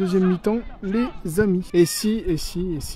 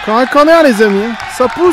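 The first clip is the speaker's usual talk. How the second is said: higher, loud pressing commentary; excited commentary; 4 semitones higher, 10 dB louder than usual. Real, not acted. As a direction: call out the corner with urgency. Corner during live commentary.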